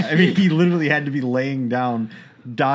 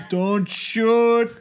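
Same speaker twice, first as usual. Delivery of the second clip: high, drawn-out fake American accent; layered fake American accent, stretched out high; Hans Gruber's American accent; 10 semitones higher, much slower than usual. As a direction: do a hammed-up, slow, high-pitched bad American accent. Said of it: "That's my Hans Gruber doing an American accent".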